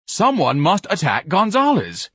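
high, loud, slow command; urgent exhortation. Acted. Ignatius pleading with the battalion to act, urgent. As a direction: urge them on, stretching the words.

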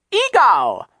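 put-on very high, loud baby shriek. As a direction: shriek like a fierce little guard. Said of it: "Sonny shrieked".

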